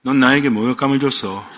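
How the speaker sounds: low, dramatic movie-boss impression; 김영철. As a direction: drop deep for the famous film line. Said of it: "아, 김영철?".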